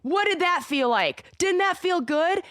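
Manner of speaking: bright, high, slow praise for her son; delighted mom. Retold or imitated. Mom praising her drumming son, high and delighted. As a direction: cheer him on, thrilled he got it. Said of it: "And she's like".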